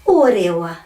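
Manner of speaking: O, re, wa joined with long pure O; falling or-re-wa, tapped r; correct Māori pronunciation. Shown as a demonstration. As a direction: model O, re, wa smoothly. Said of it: "only ever going to be o".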